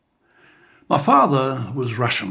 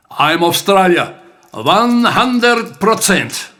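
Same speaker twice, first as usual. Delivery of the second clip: louder, slow proud declaration; loud and clear; 7 semitones higher, 7 dB louder, slower than usual. Retold or imitated. Dad answering the heckler at the bar, loud and clear. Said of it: "said with voice loud and clear".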